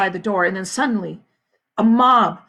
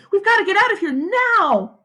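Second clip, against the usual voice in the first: urgent; 11 semitones higher, 5 dB louder than usual. A narrator's reading. Catherine urgent.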